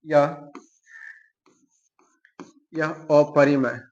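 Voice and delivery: drawn-out words, paced for note-taking; slow dictation. Real, not acted. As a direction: say it slowly while writing. तो हम लोग लिखेंगे. (लिखते हुए धीरे-धीरे बोलना)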